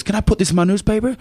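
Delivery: a hint of a keen reporter asking permission; eager journalist. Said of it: "And he goes".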